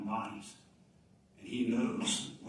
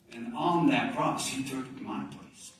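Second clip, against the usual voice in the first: slow emphasis; 5 dB louder, slower than usual. Real, not acted. Preaching climax slowed.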